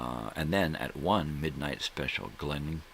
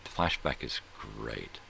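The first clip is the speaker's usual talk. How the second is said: ordinary talk, nothing marked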